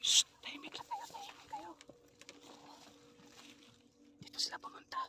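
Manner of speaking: urgent hushing whisper. Real, not acted. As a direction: hush them, then whisper. Telling others to keep quiet.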